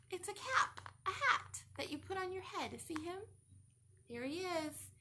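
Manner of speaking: ordinary delivery, nothing marked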